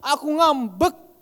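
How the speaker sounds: slow, grumbling sulk on "ngambek"; "ngambek" dragged out sourly, like a grown-up really sulking; resentful "Aku ngambek"; sulky way. Shown as a demonstration. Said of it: "Kalau kita".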